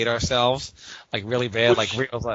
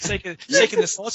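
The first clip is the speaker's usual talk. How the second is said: pitched way up, mocking the salt shaking; squeaky mimic of a gloating rival; high mock voice. Teasing his friend about the salt shaker, high mocking voice.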